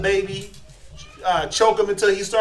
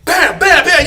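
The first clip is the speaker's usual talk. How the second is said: much louder, higher mimicked slaps; laughing thumps; 10 semitones higher, 12 dB louder, slower than usual. Nurse burping the baby too hard, laughing thumps. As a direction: pound out each bam, laughing.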